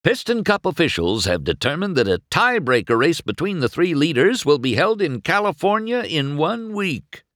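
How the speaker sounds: fast, loud announcer read. Acted. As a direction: rattle off the official ruling. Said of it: "Bob announced".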